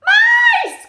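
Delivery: very high, loud shriek; loud cartoon cat scream; startled cry. A narrator's reading. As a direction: shriek it in storybook alarm. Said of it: "cried Boswell".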